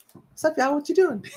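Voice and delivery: the cat peeking in and greeting everyone; voicing the cat. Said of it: "she'll be like".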